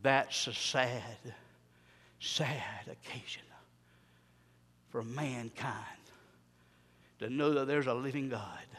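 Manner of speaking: very soft, low and halting; hushed, solemn preaching. Real, not acted. Preacher lamenting mankind's rejection of God, hushed and solemn. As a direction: grieve quietly, with long pauses.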